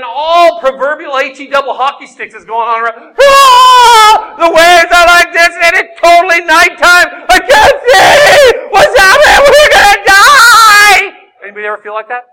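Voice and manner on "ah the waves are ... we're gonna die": screamed, high and loud, in terror; panicked screaming. Acted. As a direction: cry out like you're drowning in a storm. Disciples panicking in storm.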